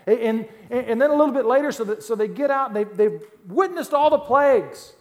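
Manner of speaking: loud, high, fired-up pulpit preaching; preaching at full force. Acted. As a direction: preach it hard to the congregation.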